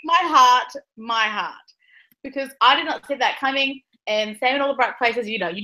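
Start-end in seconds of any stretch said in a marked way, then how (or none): 0.0-1.5 stunned. Retold or imitated. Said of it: "it hit me like a bus".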